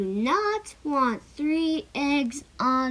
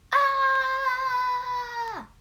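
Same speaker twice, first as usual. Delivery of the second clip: panicked high yelp; 9 semitones higher than usual. Acted. Panics at bird on head.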